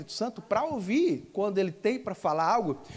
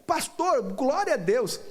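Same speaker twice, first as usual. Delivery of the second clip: high, emphatic retold exclamation; imitated jubilant praise; 4 semitones higher, slower than usual. Healed woman assuring the pastor, imitated jubilation. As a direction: burst into her praise at a raised pitch. Woman affirms her healing, re-enacted.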